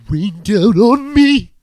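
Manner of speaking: high, loud, slow overwrought belt; hammed-up grunge wail; mock angry singer. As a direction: parody a singer straining to sound angry. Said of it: "he's trying to sound angry when he's like".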